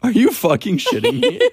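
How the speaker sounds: high, loud indignant cry; hammed-up shock at an insult; playful yelp of offended disbelief; mock outrage. Mock outrage at lowered rating.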